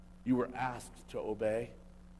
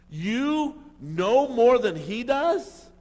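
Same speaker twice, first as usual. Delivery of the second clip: much higher and louder, drawn out slowly; incredulous challenge; 9 semitones higher, 10 dB louder, much slower than usual. Real, not acted. Preacher confronting critics of Jesus, loud, slow disbelief. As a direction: stretch it out in disbelief.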